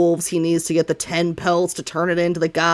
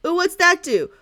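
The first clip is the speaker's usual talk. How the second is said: put-on high, eager kid-like questions; nagging backseat gamer; 8 semitones higher than usual. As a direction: pester him with question after question. GM voices curious Tinder.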